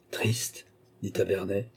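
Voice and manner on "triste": breathy whispered echo of the word; an unvoiced, disbelieving echo; stunned whisper. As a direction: barely voice it, taken aback. Baron echoes the word, stunned.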